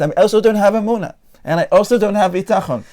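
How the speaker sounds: high, loud self-accusation; mock self-blame. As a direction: pile on the guilt, exaggerated and emphatic.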